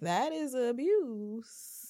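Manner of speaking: drawn-out disapproval. Retold or imitated. Rebutting fans of the thorn ring, slow emphatic disapproval. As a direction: drag out each word in judgment. Deadpan answer to gushing people.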